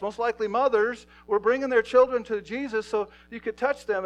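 loud, high sermon peak; fiery preaching. Acted. Pastor urges the congregation about children, fiery and loud. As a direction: preach it at full force.

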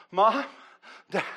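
imitated child calling out, short and high; anxious calling. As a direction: call into an empty house, pausing between. Retold son calls louder into silence.

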